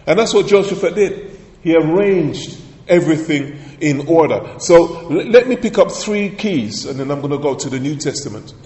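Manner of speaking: ordinary talk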